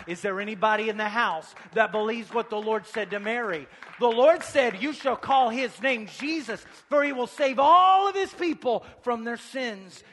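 loud, high, building preacher's cry; full-force preaching. Real, not acted. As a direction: preach it out, punching the key words.

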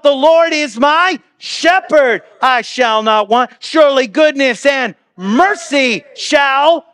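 loud, slow, high preaching of the verse; proclaimed scripture. Acted. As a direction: proclaim it slowly, rising with joy. Preacher proclaiming favorite psalm verses.

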